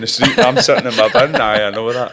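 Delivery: loud, laughing self-mockery, pitch up high; laughing loudly. Real, not acted. Joking with the host about his ice bin, laughing loud. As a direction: laugh it out while joking at yourself.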